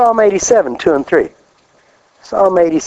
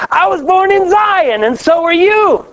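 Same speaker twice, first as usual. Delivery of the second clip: preaching declaration; 12 semitones higher than usual. Real, not acted.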